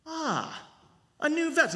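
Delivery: put-on boy discovering his new vest; delighted boy voice. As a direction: gasp it out like a surprised child.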